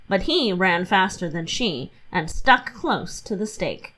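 plain reading voice

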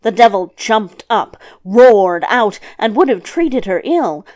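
ordinary delivery, nothing marked

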